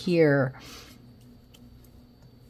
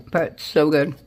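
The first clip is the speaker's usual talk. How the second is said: words round a bite of chocolate; eating chocolate; 4 dB louder than usual. Real, not acted. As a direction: mouth full of chocolate. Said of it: "Now I have chocolate in my mouth".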